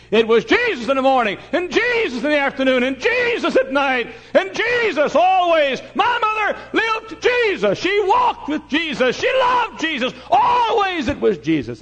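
high, slow, shouted testimony; full-force preaching. Retold or imitated. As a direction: cry out each phrase at the pulpit's peak. Sermon climax in retold testimony.

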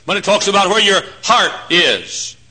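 ordinary talk

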